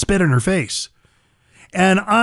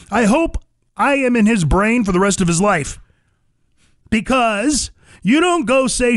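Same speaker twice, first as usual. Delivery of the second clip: ordinary talk, nothing marked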